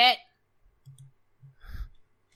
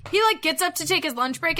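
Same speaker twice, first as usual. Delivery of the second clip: ordinary delivery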